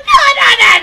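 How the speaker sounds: high, loud mock wail of denial; hammed-up panicky protest. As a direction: imitate yourself frantically refusing. Retells brushing off a bad feeling.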